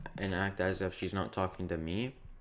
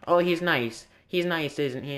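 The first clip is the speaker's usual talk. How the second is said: higher, louder mock-suspicious tease; insinuating; 6 semitones higher, 4 dB louder than usual. Karen hinting at the speaker and the worker, in a higher, louder teasing insinuation. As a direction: needle him about the nice worker.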